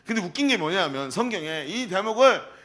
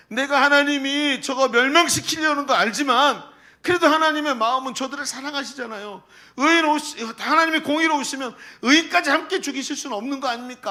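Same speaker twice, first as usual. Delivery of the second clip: higher, louder, earnest begging to God; pleading prayer; 7 semitones higher, 4 dB louder than usual. Acted. Preacher acting Abraham's plea to God, urgent pleading. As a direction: plead as if holding on to God's robe.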